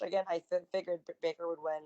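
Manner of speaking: ordinary talk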